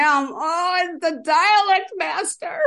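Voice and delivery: high, drawn-out pretend dread; hammed-up reveal, pitched right up and stretched; mock-dramatic announcement. Host announcing the dreaded game master, hammed-up and high.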